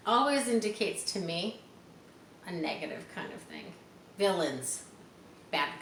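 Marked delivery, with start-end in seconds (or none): none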